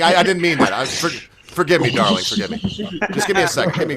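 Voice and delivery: tipsy, slurred apology. Real, not acted. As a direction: talk like you've had a few. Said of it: "I've been drinking".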